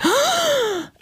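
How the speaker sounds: big, high intake of breath; mock frightened gasp. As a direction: gasp like a scared child, hammed up. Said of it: "And I would be like".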